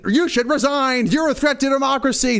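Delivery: high-pitched accusing outburst; mock attack. Liberals attacking a Conservative over trifles, mock outrage. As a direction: hurl it like an outraged opponent. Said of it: "The liberals attack them".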